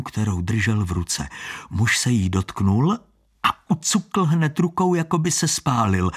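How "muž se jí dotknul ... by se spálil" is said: usual speaking voice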